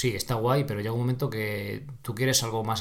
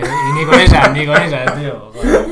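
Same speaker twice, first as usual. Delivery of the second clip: much louder, laughing through it; 3 semitones higher, 15 dB louder than usual. Real, not acted. Joking that shirtless videos still didn't sell, laughing loudly. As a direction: laugh it out in mock disbelief.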